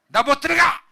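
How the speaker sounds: high, full-volume refusal; angry shouting. Acted. Older brother refusing to join the feast, angry shout. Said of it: "이런 때 소리 질러보지 언제 질르겠어요".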